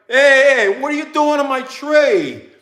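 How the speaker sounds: loud, high yell across the yard; retold yell at the crew. Yelling at the power crew cutting his tree, loud and high. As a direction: shout them down to save the tree.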